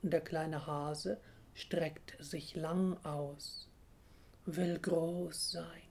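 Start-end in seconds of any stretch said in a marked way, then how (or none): 4.4-5.8 slow, whispered wish; whispering. A narrator's reading. Little hare to himself, whispering. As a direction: whisper it slowly and secretly. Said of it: "flüstert er".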